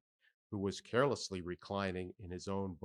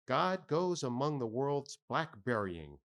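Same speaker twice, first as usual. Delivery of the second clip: ordinary delivery, nothing marked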